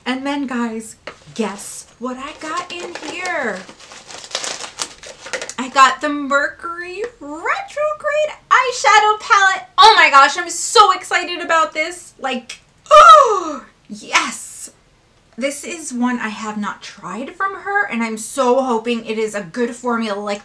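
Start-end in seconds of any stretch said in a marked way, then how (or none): 5.6-14.7 louder and higher, gasping with joy; excited. Real, not acted. Revealing the palette to viewers, squealing excitedly. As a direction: burst out, thrilled. Unboxing a palette she wanted.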